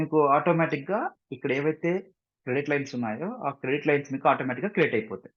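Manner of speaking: ordinary talk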